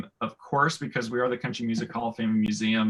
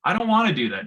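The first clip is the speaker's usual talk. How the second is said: high, loud mock yelling; imitated student yell; 9 semitones higher, 7 dB louder, faster than usual. Teacher imitating disrespectful student at back of room, yelling. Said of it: "and just yell out".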